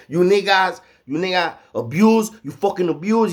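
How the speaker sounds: high, loud, furious broken English; retold angry outburst. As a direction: shout it up high like he's fed up. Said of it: "He was pissed the fuck off".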